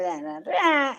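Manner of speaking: high-pitched mock babble; mocking blather. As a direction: rattle it off as dismissive nonsense. Mockingly voicing the radio statement.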